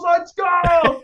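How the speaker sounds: high, loud celebratory shout; hyped cheer. Retold or imitated. Julie cheering her son's winning shot, loud high cheer. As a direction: cheer like a proud mom courtside. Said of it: "She, like, goes".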